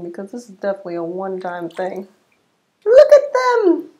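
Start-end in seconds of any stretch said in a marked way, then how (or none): 2.8-3.9 loud, high, slow delighted cry; excited shout. Real, not acted. Vlogger showing viewers her curls coming back, thrilled shout.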